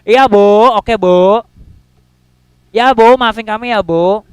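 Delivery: loud, brushed-off "yes ma'am"; careless apology. Retold or imitated. Students brushing off a nagging teacher with loud careless yeses. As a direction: shrug off the nagging teacher loudly. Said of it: "udah biasa lah ibu ini marah-marah".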